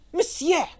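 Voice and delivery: high, loud, indignant French protest; sharp exclamation of objection. A narrator's reading. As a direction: object hotly, pitched well up. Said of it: "protested the Vicomte".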